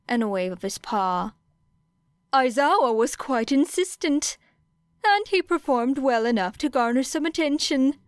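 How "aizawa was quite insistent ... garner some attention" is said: chirpy high-voiced principal explaining. A narrator's reading. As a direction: pitch it up like a small cheerful creature. Nezu voiced by narrator.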